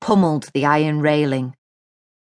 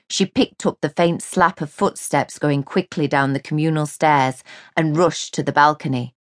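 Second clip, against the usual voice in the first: ordinary delivery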